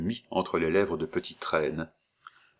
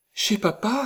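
put-on much higher, excited child; joyful cry; 3 dB louder than usual. Little girl cries out with joy, much higher. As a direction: squeal with joy. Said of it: "s'écria Justine".

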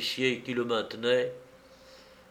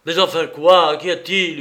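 loud, high-pitched outraged yell; raised, indignant outburst; 5 semitones higher, 8 dB louder than usual. A narrator's reading. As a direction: yell the questions, demanding an answer. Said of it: "hurla Don Louis".

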